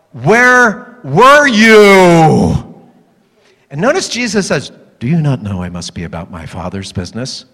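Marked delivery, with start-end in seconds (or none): 0.1-2.6 drawn-out exasperated demand, each word stretched; not calm at all. Acted. Parents who walked back for Jesus, exasperated. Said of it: "it was not that calm. I'm thinking it was more like". 5.0-7.5 very low, soft, solemn reply; serene Jesus. Acted. Young Jesus answering his upset parents, serene.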